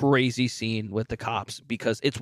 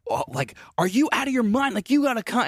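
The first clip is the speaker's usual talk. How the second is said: Tess pleading; 9 semitones higher than usual. Retold or imitated. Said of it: "she's just like".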